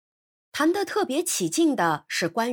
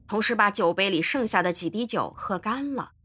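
ordinary delivery, nothing marked

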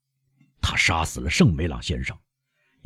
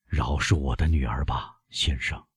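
softer, half-whispered plea; in a low voice; 6 semitones lower, 5 dB quieter than usual. A narrator's reading. Father pleads with the doctor for his daughter, whispering. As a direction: beg for your daughter under your breath. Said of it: "维勒夫小声说".

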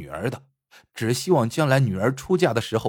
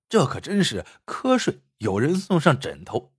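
ordinary delivery, nothing marked

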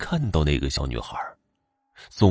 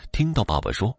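usual speaking voice

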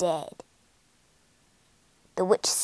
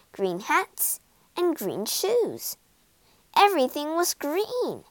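ordinary delivery, nothing marked

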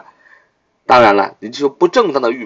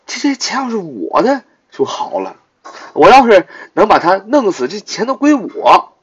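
lightly voiced greedy poor guy, higher pitched; a teacher acting out a scheming have-not; greedy wish; 8 semitones higher than usual. (老师扮演贪心的穷小子，声音偏高)

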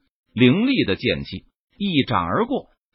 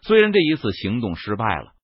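ordinary delivery, nothing marked

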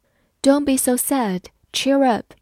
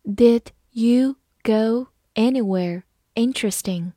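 slow, careful read-aloud; teacher reading slowly. Shown as a demonstration. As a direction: spell each word out slowly for learners. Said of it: "好，慢慢来读".

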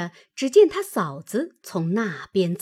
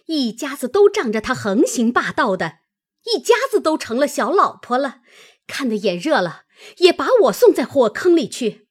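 scornful scolding, rising higher and louder toward the end; angry cursing; 5 semitones higher, 5 dB louder than usual. A narrator's reading. Maid cursing her meddling sister-in-law, mounting anger. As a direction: lash out, building in fury. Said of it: "指着他骂道".

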